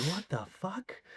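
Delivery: slow, soft, stunned "what the fuck"; stunned disbelief. Retold or imitated. Alone in his head about the unsafe stunt, stunned and soft. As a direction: let the shock drain out of it. No one asks about safety.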